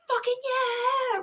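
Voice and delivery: drawn-out high excited cheer. Retold or imitated. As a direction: whoop it up, slow and high. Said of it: "we were like".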